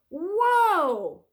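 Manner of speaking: high, loud thrilled whoa; crying out. Acted. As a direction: cry out as you lift off. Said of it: "cried Leonardo".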